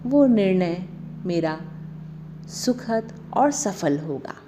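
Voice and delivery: measured meditation voice with long pauses between phrases; guided meditation. Acted. Guiding listeners through a Navratri meditation on divine powers, meditative. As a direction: lead a meditation, phrase by phrase, unhurried. तो चलिए start करते हैं meditation.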